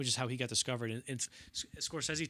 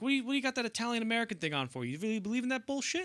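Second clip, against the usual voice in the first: higher, fast, needling; mock Pesci jab; 9 semitones higher, faster than usual. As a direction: needle him, pitched up and quick. Pesci retorting.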